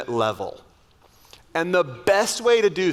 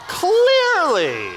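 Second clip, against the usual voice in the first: high, louder sarcastic "Clearly" stretched out; mock amazement; 13 semitones higher, 5 dB louder than usual. Real, not acted. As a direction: play "Clearly" as an exaggerated ironic exclamation, pitched high. Sarcastic joke to the audience.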